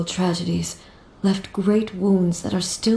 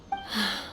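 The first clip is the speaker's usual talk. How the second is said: heavy sigh; 9 dB quieter than usual. Acted. Grieving over lost friends.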